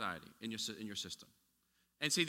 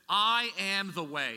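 very slow, weighty and raised; solemn declaration; 4 semitones higher, 5 dB louder, much slower than usual. Acted. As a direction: lay each word down slowly. Jesus' answer, drawn out.

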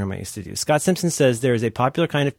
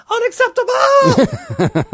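karate yell; 7 dB louder, much slower than usual. Retold or imitated. Phone ringtone voice shouting.

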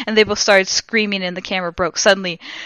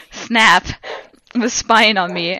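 usual speaking voice

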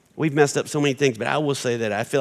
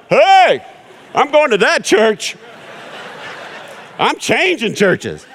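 high, loud, slow excited call from a passing car; impressed passerby shout; 11 semitones higher, 8 dB louder, much slower than usual. Retold or imitated. Passerby shouting at the church parking lot, high and loud. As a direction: yell it like a stranger driving past who just saw something amazing. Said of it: "People are driving by going".

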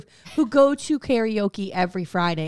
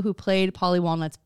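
usual speaking voice

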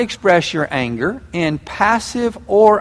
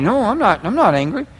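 imitated tight, higher-pitched insistence; gritted-teeth denial; 5 semitones higher than usual. Angry person who won't admit it, gritted-teeth denial. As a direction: deny it through clenched teeth. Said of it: "You can hear 'em grinding".

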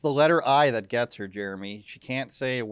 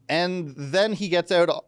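ordinary talk

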